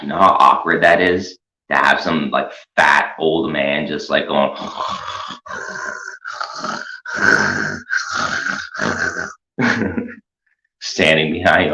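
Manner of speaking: usual speaking voice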